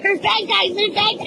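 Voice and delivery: high-pitched, urgent mock warning; retold panic. Warning Finn on the floor, mock-panicked high voice. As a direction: re-enact a frantic warning to a child. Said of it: "So I went straight panic".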